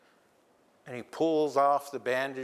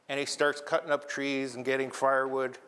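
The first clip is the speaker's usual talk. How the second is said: plain reading voice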